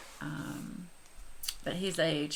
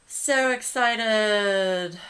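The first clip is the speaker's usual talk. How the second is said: slow, louder, stretched-out thrill; drawn-out excitement; 4 semitones higher, 9 dB louder, much slower than usual. Real, not acted. Vlogger admitting to viewers she got carried away, drawn out and excited. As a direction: savor every word, thrilled. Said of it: "I got, like, so excited".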